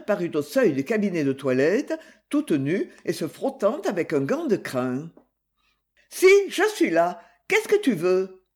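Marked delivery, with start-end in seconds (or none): 6.1-8.4 loud, high call from the next room; a bright raised shout of here I am. A narrator's reading. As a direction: announce yourself from the doorway. Calling from bathroom doorway.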